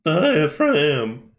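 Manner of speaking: hammy, elongated repeat of one word; drawn-out mock echo. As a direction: stretch the word slowly and theatrically.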